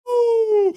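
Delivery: long, very high, loud woo; ecstatic whoop. Real, not acted. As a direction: whoop for the championship. Celebrates the title win.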